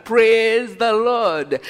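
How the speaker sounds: loud, slow "Praise the Lord" with the Lord drawn out; mock-jubilant cry of praise; exaggerated praise. Mocking people thankful for good things.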